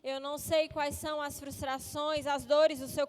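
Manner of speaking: low, soft preaching over music; hushed worship-leader voice; soft sermon intro. Acted. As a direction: draw them in gently, low and quiet.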